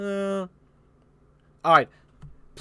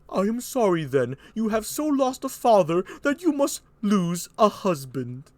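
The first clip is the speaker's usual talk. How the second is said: high, put-on courtly voice; Burgundy; 5 semitones higher than usual. Burgundy apologising to Cordelia, high courtly voice. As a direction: regret it formally in a raised voice.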